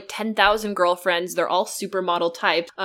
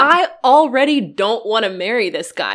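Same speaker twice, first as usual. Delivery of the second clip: louder, fed-up aside about the suitor; real exasperation with the character, said louder; exasperated aside; 4 semitones higher, 7 dB louder than usual. Real, not acted. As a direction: roll your eyes at him out loud.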